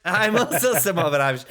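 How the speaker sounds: quick, high mock Irish; put-on Irish accent. Joking about winning over the Irish passenger, mock Irish accent. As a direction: rattle it off as a pretend Irishman. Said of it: "Did you pretend to be Irish".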